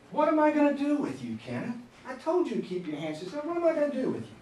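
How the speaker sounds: high, scolding, fed-up teacher; exasperated teacher. Retold or imitated. Teacher scolding Kenneth for not keeping his hands to himself, exasperated. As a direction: sigh it out at the kid. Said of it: "you'll hear this".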